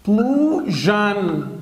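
بلوجن stretched very slowly, plo-zhn; slow syllable demo. Shown as a demonstration. Teacher drags out the word for students, slowly. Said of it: "زي كلمة مثلًا اكس".

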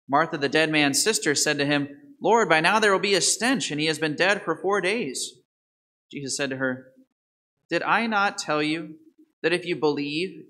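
ordinary talk, nothing marked

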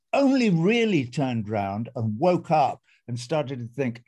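normal talking voice

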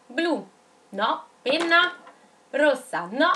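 ordinary talk

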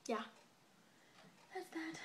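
talking while chewing gum. Real, not acted. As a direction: chew gum between words. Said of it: "I'm sorry I'm chewing gum".

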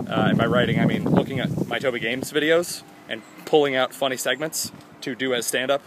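usual speaking voice

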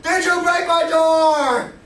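high, loud raging interrogation shouts; furious drill sergeant. Retold or imitated. Drill sergeant grills the troops about his door, high loud furious shouting. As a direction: scream at the lined-up troops. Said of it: "He's like".

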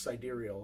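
"sidereal" as sy-DEER-ee-ul; preferred pronunciation. Shown as a demonstration. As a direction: give "sidereal" all four syllables, stress on the second. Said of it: "I think it's pronounced".

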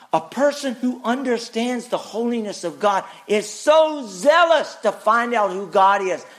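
ordinary talk, nothing marked

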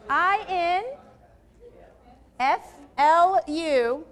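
drawn out, loud letter by letter; slow spelled letters. Shown as a demonstration. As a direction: spell it out slowly and loudly. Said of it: "I'm gonna spell the word influence for you".

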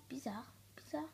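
whispered to himself; boy whispering to himself at night. Acted. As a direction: breathe it out, puzzled. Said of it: "se dit Pierre".

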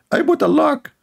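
put-on girl boasting to her friend in falsetto; girly falsetto. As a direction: squeak it like a silly proud girl. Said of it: "like two girls, they are talking".

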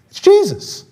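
bursting out the name; a sudden high exclamation; excited. Real, not acted. Answering his own question.

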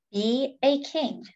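plain reading voice

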